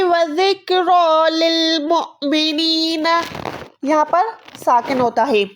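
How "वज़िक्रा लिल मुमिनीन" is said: वज़िक्रा लिल मुमिनीन recited slowly in tajweed style; Quranic recitation. Shown as a demonstration. Teacher recites a Quran example for the class, slow chant. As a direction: chant it slowly with a stop on the hamza. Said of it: "Quran पाक में ऐसे हरूफ आता है".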